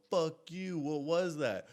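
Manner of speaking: mildly heated retold outburst; indignant retort. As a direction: act out cursing a friend in disbelief. Acting out his startled demand.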